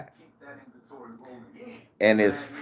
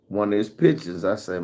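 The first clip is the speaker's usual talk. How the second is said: normal talking voice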